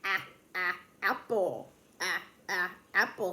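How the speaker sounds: short, separate "ah" sounds before "apple"; repeated short "ah", then "apple", evenly spaced; letter sound demo. Shown as a demonstration. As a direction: sound out the first vowel of "apple". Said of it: "that A makes that ah".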